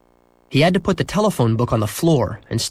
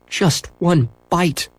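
slow, higher begging voice; pleading dog; 6 semitones higher, much slower than usual. A narrator's reading. Dog begging Henry for ice cream, slow plea. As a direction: draw out each word, like a hungry dog begging. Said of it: "the dog's brown eyes seemed to say".

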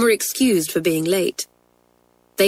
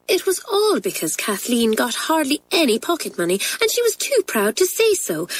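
put-on higher, rushed and earnest girl voice; pleading schoolgirl; 6 semitones higher than usual. Twins pleading with the head for Kathleen, earnest girl voice. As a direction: pour it out like an anxious schoolgirl.

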